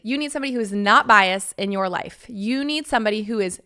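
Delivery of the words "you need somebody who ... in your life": normal talking voice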